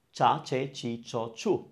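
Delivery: "cha, che, chi, cho, chu" in an even row; CH-syllable drill. Shown as a demonstration. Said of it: "let's do the ch sound".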